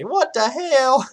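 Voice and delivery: high, loud, drawn-out mock Southern twang; Southern accent. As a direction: exaggerate a Southern accent, stretching HELL like hail. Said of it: "funny to listen to people say".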